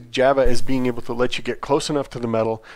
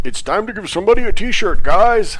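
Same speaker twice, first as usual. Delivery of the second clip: high, loud mock announcement; imitated giveaway signal; 9 semitones higher, 7 dB louder than usual. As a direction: call it out like a giveaway cue. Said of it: "Dan's giving us the magic".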